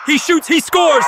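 loud, high goal call; goal yell. Real, not acted.

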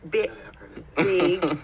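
"big" as a mimicked Canadian "bag"; Canadian pronunciation. Guest teases host's Canadian "bag", mimicking it as "big". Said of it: "You say bi-, big".

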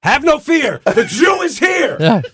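high, loud triumphant yell of the catchphrase; Ari Gold shout. Retold or imitated. Guest quoting his famous Entourage line, shouted high and loud. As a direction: belt out the famous line like a swaggering agent. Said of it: "Famous line".

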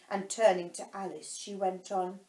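ordinary delivery, nothing marked

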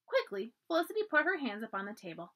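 plain reading voice

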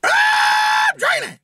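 very high screeching mock shout; hammed-up, high-pitched frenzy; mock jumping monkeys. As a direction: shriek it like an excited monkey mob. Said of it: "all your jumping jack monkeys are".

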